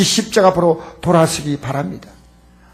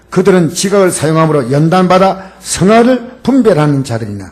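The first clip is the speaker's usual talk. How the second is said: scripture reading; 4 semitones lower than usual. Acted. Pastor reading Hebrews to the congregation, formal and solemn. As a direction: read the verse aloud to the church with weight.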